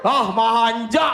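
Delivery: ejekan kesal yang diulur lambat; omelan kesal tiruan. Retold or imitated. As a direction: tirukan keluarga yang jengkel, lambat sekali. Menirukan keluarga kesal membalas keluhan. (pura-pura kesal pada si manja)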